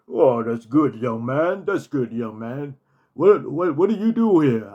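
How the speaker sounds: a hint of James Earl Jones; James Earl Jones impression.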